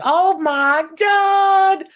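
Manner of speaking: very high, slow screaming Oh my God; hammed-up shriek of terror; panicked crowd. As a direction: scream it like a terrified bystander. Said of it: "everyone's like".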